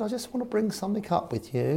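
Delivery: put-on high-pitched posh accent; [name]. Chairman answers his manager politely, mock posh high voice. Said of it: "'cause very posh, Eddie".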